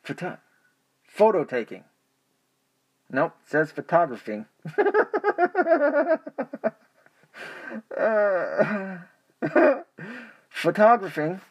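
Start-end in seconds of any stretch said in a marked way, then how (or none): 4.6-6.7 high squealing laugh; giggling fit. Real, not acted. Laughing at own stumble. 8.0-11.4 word squeezed out through giggles; high laughing voice. Real, not acted.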